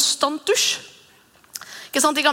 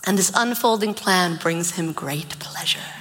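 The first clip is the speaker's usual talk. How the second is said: slow breathy whisper; hushed reading; 4 semitones lower, slower than usual. Acted. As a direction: whisper the verse slowly, like a secret. Reading a Bible verse softly.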